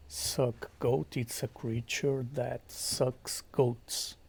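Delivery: usual speaking voice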